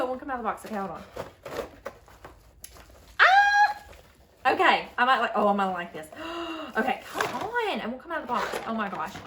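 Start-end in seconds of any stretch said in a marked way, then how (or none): 3.2-4.5 excited squeal. Real, not acted. Item finally comes out.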